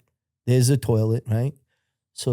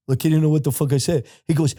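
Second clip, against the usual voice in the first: usual speaking voice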